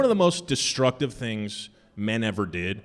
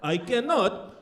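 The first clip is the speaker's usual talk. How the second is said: put-on higher, slow broken-English Turkish accent; mock Turkish accent; 12 semitones higher, slower than usual. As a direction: play the flustered actor who forgot the script, Turkish accent. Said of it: "he was like".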